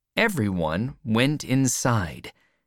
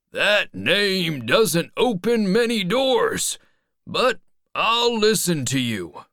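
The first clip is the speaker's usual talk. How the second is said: high, raised voice; old man calling out; 9 semitones higher, 3 dB louder than usual. A narrator's reading. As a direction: call down to the boy guardedly.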